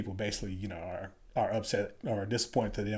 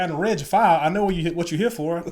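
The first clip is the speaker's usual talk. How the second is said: louder, higher retold challenge; mock sergeant swagger; 7 semitones higher, 9 dB louder than usual. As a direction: lay it on him like you've got his number. Sergeant sizing him up.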